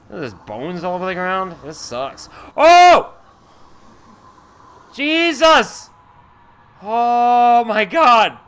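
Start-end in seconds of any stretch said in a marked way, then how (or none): 2.5-8.4 real startled yelps, high and loud; sudden fright shout. Real, not acted. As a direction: jump at the scare and cry out. Sudden scare in game.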